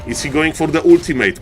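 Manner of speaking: ordinary talk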